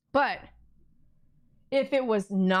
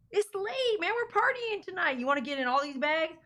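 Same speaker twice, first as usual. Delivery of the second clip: talking to the dog; 6 semitones higher, 3 dB quieter than usual. Real, not acted. Owner chatting to her dog, high playful baby voice. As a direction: tease the puppy in a squeaky voice.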